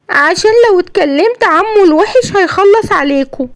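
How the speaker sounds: put-on a frightened boy confiding in his mum; scared little boy. Yassin tells his mother he fears for her, childlike and timid.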